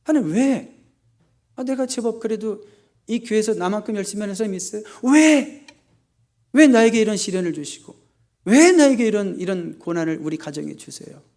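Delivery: high-pitched protest, loud on each why; indignant complaint. Acted. Believer asking God why trials came, indignant. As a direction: plead your case to God, aggrieved.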